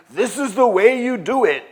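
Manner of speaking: high, loud mimicry of people laying down rules; mock dogmatic insistence. Quoting people who insist on their customs, mock insistent. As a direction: push it up high and loud, imitating know-it-alls. Mocking what people always say.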